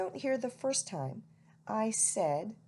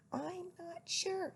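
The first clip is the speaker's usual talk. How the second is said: owlet voice; 9 semitones higher, 6 dB quieter, slower than usual. A narrator's reading. Plop voiced in story.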